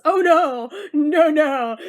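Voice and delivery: loud mock oh no; mock dismay. Narrator reacting to grandfather's death, loud mock dismay. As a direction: wail it in pretend horror.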